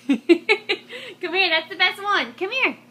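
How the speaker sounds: can't hold back the giggle; laughing delight. Real, not acted. Mother laughing as she calls her toddler over, delighted. Toddler did dinosaur roar.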